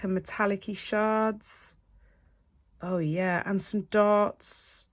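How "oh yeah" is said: ordinary talk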